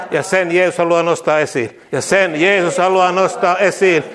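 loud, fervent call-and-repeat proclamation; rousing preacher declaring victory; preaching declarations. Acted. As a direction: proclaim each line boldly for the church to echo.

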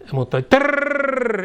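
a slow, high rolled trrrr in another voice; tongues burst. Real, not acted. Preacher breaking into tongues, slow rolled trill. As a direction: roll the r out long and high. Speaking in tongues amid sermon.

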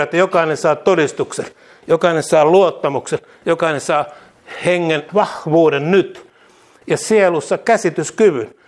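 loud, fervent call-and-repeat proclamation; rousing preacher declaring victory; preaching declarations. Acted. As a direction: proclaim each line boldly for the church to echo.

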